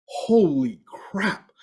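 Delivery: stunned. Retold or imitated. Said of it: "And I'm like".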